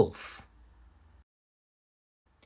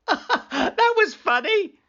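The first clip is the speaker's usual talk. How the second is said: high, slow, gloating giggle-voice; high, slow mocking delight; gleeful teasing; slower than usual. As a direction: tease them with glee. Sam laughing at villagers.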